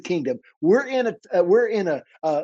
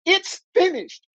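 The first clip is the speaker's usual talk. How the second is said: high, drawn-out cry; acted cry of triumph from the cross; 13 semitones higher, slower than usual. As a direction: cry it out slowly, pitched way up. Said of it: "he cried out".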